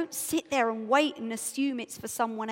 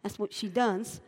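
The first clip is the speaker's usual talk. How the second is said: dismissive congregation; 4 semitones lower, 4 dB quieter than usual. Pretend. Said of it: "half of you will now be going".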